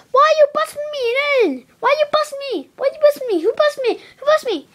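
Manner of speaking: high-pitched repeated questioning; indignant demand. Pretend. Demanding who busted me, high indignant voice. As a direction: whine it high, over and over.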